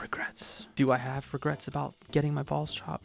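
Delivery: whispered to himself. Acted. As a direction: hush it like a secret confession. Mock secret self-questioning.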